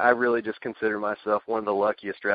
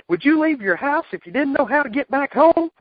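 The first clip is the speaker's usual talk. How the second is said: high, loud exasperated impression; Cornette impression; 6 dB louder than usual. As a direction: squawk it like a cranky manager. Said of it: "he, he said".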